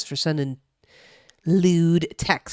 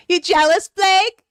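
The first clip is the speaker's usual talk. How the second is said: high, louder gloating tease; teasing; 13 semitones higher, 7 dB louder, slower than usual. Real, not acted. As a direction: rub it in, high and loud. Teasing chatter after dog kiss.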